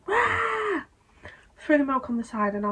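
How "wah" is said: high-pitched mock wail; sudden high imitation cry; imitated child's wail. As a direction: cry out "wah" like a startled child. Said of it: "and I heard".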